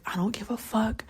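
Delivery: unvoiced whisper in bed. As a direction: breathe it out to yourself. Quoting self whispering in bed.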